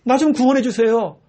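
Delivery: high, loud pleading cry; desperate plea for rescue. Retold or imitated. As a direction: beg loudly like the blind man. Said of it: "오늘 바디메오처럼".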